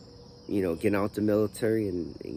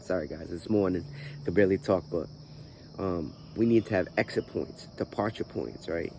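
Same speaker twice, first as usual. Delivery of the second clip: ordinary talk, nothing marked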